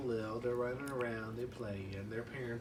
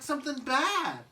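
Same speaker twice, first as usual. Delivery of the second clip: put-on higher, louder baby voice; Chucky; 13 semitones higher, 8 dB louder than usual. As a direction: squeak it out. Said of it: "he's like".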